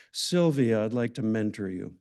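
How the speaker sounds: ordinary talk, nothing marked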